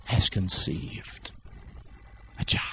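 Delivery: whispered, slow and hushed; secretive whisper. Retold or imitated. Angel confides to Mary, hushed whisper. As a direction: tell the secret under your breath.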